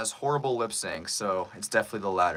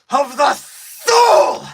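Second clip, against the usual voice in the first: very high, slow, drawn-out shouted cry; anime climax cry; 13 dB louder, much slower than usual. Acted. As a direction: stretch "soul" into a soaring cry. Climax of dubbed anime line.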